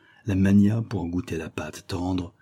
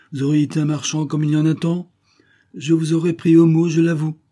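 high, louder, quick character voice; client, frankly confessing; 8 semitones higher, 6 dB louder than usual. Acted. Client admits to the dealer he'd exploit others, frank. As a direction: confess it briskly and emphatically.

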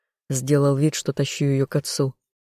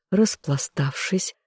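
ordinary delivery

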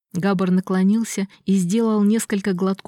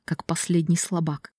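ordinary delivery, nothing marked

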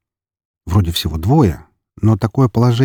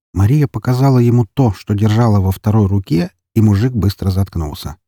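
ordinary talk

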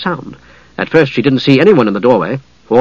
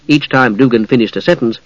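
ordinary delivery, nothing marked

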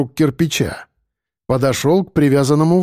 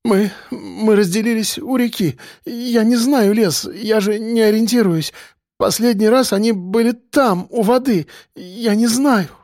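high-pitched frightened stammer; trembling, breaking voice; 7 semitones higher than usual. A narrator's reading. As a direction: try to keep steady but falter. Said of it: "Лиза дрожала, пытаясь говорить ровно, но всё равно срывалась".

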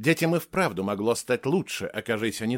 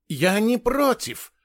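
high, flustered agreement; agitated; 10 semitones higher, 4 dB louder, slower than usual. A narrator's reading. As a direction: agree hurriedly in spirit, pitch raised. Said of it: "взволнованно произнёс доктор Морган". (взволнованно)

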